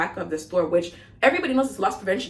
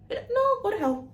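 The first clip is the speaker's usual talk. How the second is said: defiant retort; 13 semitones higher, slower than usual. Acted. Acted defiant reply to insult.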